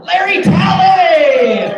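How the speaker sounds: high, slow, drawn-out name call; announcer shout. Real, not acted. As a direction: belt the name out like a host.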